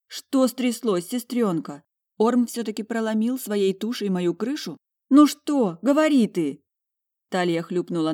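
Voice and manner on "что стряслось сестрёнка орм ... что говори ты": raised, higher anxious questioning; urgent demand. A narrator's reading. Demanding answers from her crying sister, urgently. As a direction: build from worry to a loud insistent order.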